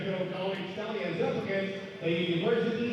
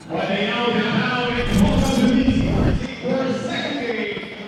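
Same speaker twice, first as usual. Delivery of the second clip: fighting game announcer; 3 semitones higher, 9 dB louder than usual. Acted. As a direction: hype up the crowd like a ring announcer. Game announcer introduces second game.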